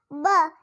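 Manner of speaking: ब as a short "buh", not "bee"; phonics letter sound. Shown as a demonstration. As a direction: say ब as the bare B sound. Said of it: "इसका sound क्या होगा?". (ब की छोटी ध्वनि)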